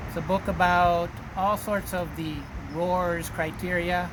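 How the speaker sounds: usual speaking voice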